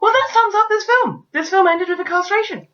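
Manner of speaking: high, sardonic disbelief; sarcastic film critic voice; incredulous verdict. Retold or imitated. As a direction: pitch it up in mock astonishment. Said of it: "and we're like".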